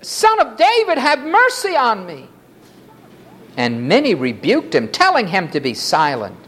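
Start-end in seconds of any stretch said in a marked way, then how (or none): 0.0-2.3 very high-pitched beggar's call; crying out. A narrator's reading. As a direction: cry up high for mercy. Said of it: "began to cry out and say".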